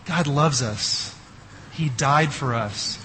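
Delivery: normal talking voice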